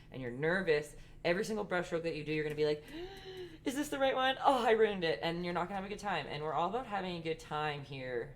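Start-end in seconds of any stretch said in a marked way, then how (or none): 2.9-3.6 sharp high gasp of alarm; mock nervous gasp. Nervous painter fearing a mistake. 3.6-5.2 mock panic. Nervous painter fearing a mistake.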